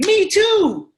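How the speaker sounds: high and loud, all in; the excited answer to any reply; enthusiastic agreement. Acted. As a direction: beam it back at them. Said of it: "Here's my answer".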